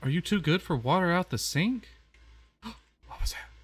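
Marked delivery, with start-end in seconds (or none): 2.6-3.5 gasped, breathless whisper; startled whisper. Real, not acted. Spooked player whispers after a strange noise, startled. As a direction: catch your breath and whisper it.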